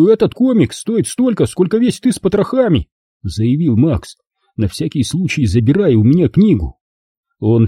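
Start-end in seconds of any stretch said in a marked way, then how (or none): 0.1-2.9 a boy voiced a touch higher, standing up for the comic; defiant little friend; defensive Max. A narrator's reading. Max defending the comic's worth to Rob, lightly voiced and defiant.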